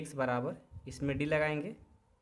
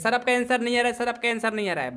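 complaining students; 6 semitones higher, 7 dB louder, faster than usual. Retold or imitated. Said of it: "teacher को डांटने बोलने लग जाते हैं".

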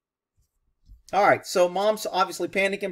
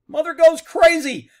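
mock frantic cry; 11 semitones higher, 10 dB louder than usual. Mare panicking in the video.

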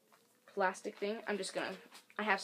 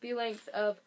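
words stretched by a yawn; slower than usual. Real, not acted. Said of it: "now I'm yawning".

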